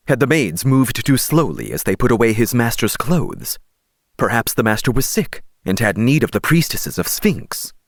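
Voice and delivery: ordinary delivery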